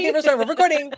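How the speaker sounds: high, loud eager echo of her own line; echoing own catchphrase. Retold or imitated. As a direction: repeat it back, eager and bright. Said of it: "The first thing you do when I meet you is like".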